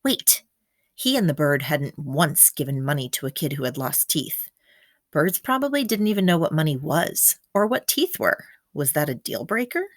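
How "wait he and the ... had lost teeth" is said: ordinary delivery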